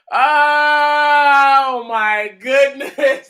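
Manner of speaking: very slow amused oh my goodness; long drawn-out amused exclaim; slow amused drawl. Real, not acted. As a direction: stretch it out, tickled. Laughing at viewer.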